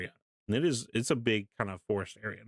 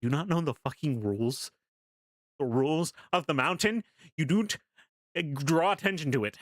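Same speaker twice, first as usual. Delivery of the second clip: higher, hammed-up lecture on the rules; mock stern scolding; 4 semitones higher than usual. To his girlfriend in the tent, a mock stern scolding. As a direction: playfully scold her for making noise.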